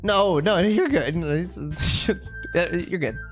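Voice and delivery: ordinary delivery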